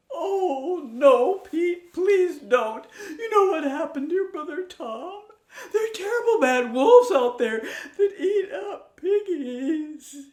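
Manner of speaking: high, loud, fretting mother sow; a mama pig begging her son to stay; high anxious mother pig with a tearful laugh; worried mama pig. Acted.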